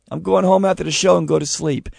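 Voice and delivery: ordinary talk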